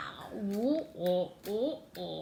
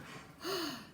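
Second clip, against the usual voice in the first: gasp; 8 semitones higher, 6 dB quieter than usual. Acted. Reading a surprise in the book.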